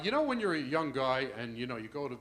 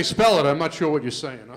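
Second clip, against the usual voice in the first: stern pharmacist; 11 dB louder than usual. Retold or imitated. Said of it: "He'd say".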